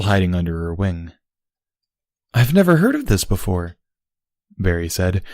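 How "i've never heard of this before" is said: higher, disbelieving; can't believe what she hears; incredulous. Acted. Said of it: "her tone incredulous".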